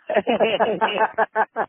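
loud, high cackle after a punchline; big laugh at own joke. Real, not acted. Prank caller cracking up at his squirrel joke with a loud cackle. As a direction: burst out laughing at your own gag.

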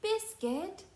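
high, slow call after the puppy. Acted. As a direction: call out so he stops and waits. Calling after the running puppy.

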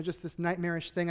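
ordinary delivery

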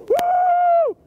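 long high-pitched "woo"; party whoop. Real, not acted. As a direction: let out a high, held celebration whoop. Cheering in the New Year.

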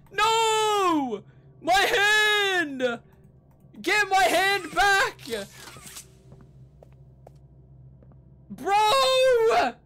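high, drawn-out cries of outrage; wailing in dismay. Real, not acted. Player whose game hand has glitched away, dismayed wailing. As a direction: wail each word long and high.

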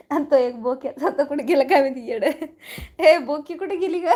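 loud talk broken by laughter; real laughing. Real, not acted. Streamer jokes about the missing one at home, laughing. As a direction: laugh through the words.

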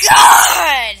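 a loud exasperated shout; frustrated shout. Real, not acted. Player attacked in the game, shouting in frustration. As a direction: bark it out in real frustration.

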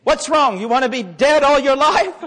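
loud, hammed-up scolding; mock exasperation. Samaritan to an unresponsive dead man, mock exasperated. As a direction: berate him in comic disbelief.

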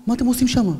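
re-enacted shouting, pitched high; retold shout. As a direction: call them over urgently. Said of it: "ואני צועק להם".

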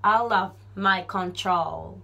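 "out of my control" drawn out slowly, "out of" linked as "ala v"; slow linked demo. Shown as a demonstration. Teacher slowly models the linked "out of" phrase. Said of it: "ofもof寄りな感じにして".

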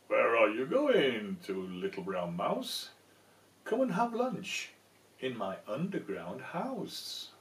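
hammed-up sly fox. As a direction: tempt the mouse to lunch. Fox invites the mouse.

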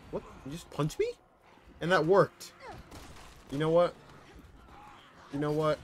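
loud, high amazement; can't believe it worked; surprised outburst. Real, not acted. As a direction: gamer shocked yell. Surprised outburst after punch.

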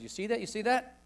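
a hint of an eager Texas legislator pointing at the Bible; retelling the legislator urging him on; imitating the congressman. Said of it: "He said".

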